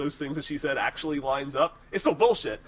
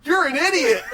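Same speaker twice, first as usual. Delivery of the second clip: mock angry yell; 12 semitones higher, 8 dB louder than usual. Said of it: "He goes".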